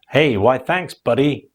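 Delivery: put-on Texan accent, friendly reply; hammed-up Texan drawl from a British speaker; American accent. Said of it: "So sorry about that accent".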